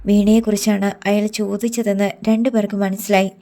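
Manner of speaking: plain reading voice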